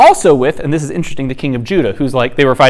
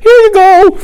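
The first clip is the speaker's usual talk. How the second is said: very high, loud sing-song; mock cheery handover; 5 dB louder, slower than usual. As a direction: imitate someone chirpily presenting a delivery. Acts out delivering heads, cheerily.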